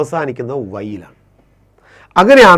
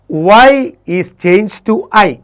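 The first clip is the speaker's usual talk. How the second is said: very slow and loud, each word spaced out; slow dictation; 6 semitones higher, 6 dB louder, much slower than usual. Shown as a demonstration. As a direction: spell out the rule for the class to copy. Teacher dictates the English rule. (ഉറക്കെ പതുക്കെ നിയമം പറഞ്ഞുകൊടുക്കൽ)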